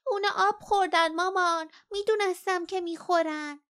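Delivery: high, loud little-one whine; grumbling child. A narrator's reading. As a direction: protest to mom in a high, put-on child voice. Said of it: "پرین از ته کیسه غرغر کرد".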